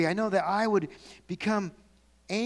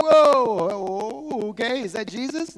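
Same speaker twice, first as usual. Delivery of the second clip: startled disciples; 3 semitones higher, 4 dB louder, slower than usual. Retold or imitated. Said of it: "They're like".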